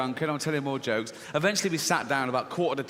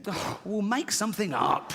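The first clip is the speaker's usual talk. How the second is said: slow, higher and drawn-out punchline; exasperated father; 8 semitones higher than usual. Acted. Father to his little girl as the joke turns, exasperated. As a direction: sigh into a stretched, fed-up dismissal.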